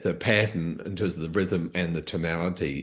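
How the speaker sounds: normal talking voice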